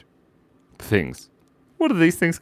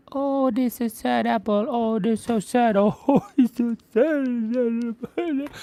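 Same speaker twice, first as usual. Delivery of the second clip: much higher, moping little fruit voice, dragging the last words out; sad apple voice; 13 semitones higher, slower than usual. Pretend. Streamer voices the sad apple she just drew, higher and moping. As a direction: pout it like a tearful cartoon apple.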